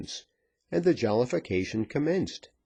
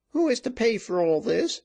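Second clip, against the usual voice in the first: high, demanding landlady voice; high-pitched businesslike challenge; exclaiming landlady; 14 semitones higher, 3 dB louder than usual. A narrator's reading. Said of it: "exclaimed the landlady".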